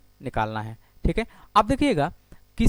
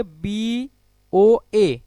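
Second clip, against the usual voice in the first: COB read very slowly, letter by letter; drawn-out angle name; 3 semitones higher, 5 dB louder, much slower than usual. Shown as a demonstration. Teacher reads COB slowly for the class, drawn out. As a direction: stretch out COB, each letter separately. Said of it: "इसको क्या बोलेंगे?".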